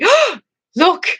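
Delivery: high, eager oh look; excited mum. Retold or imitated. Mum spotting a celebrity at the airport, eager and high. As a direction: point it out like a thrilled mum. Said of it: "my mum goes to me".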